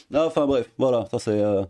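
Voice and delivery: ordinary delivery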